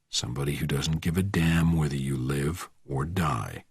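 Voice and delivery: low, near-whispered threat; quiet menace. A narrator's reading. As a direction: threaten under your breath. Bourne threatens the driver.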